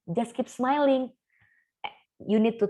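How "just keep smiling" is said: slow, mock-bright toxic cheer; mock cheery. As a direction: say it like a hollow pep slogan. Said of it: "instead of saying or thinking".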